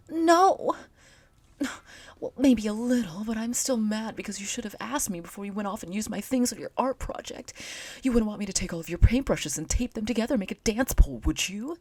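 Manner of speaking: put-on high, loud indignant girl; peeved female character; high scolding voice. Character scolds about her pole.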